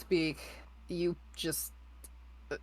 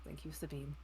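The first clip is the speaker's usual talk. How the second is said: soft, breathy under-the-breath repeat; muttering; 3 semitones lower, 19 dB quieter than usual. Acted. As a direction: mutter it to yourself, near silent. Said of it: "just muttering".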